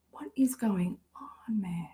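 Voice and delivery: mutters it under the breath, lost; whispering to herself in confusion; baffled whisper. Real, not acted. Streamer hunting for a lost playlist, whispering in confusion.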